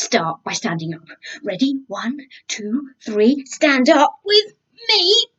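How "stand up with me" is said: excited call. Acted. Urging kids to stand.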